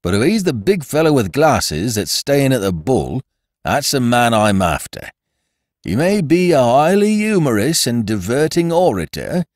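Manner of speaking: sour, bitter constable sneer; grudging, bitter policeman's remark; a policeman resenting the laughing crowd, bitterly. A narrator's reading. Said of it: "said the constable bitterly".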